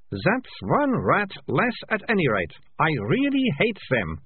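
put-on girl pleased the rat is gone; Malicia's voice. A narrator's reading. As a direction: gloat over the dead rat slowly. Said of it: "said Malicia".

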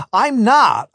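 high, urgent denial; protesting cry. A narrator's reading. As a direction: cry out your innocence. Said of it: "I cried".

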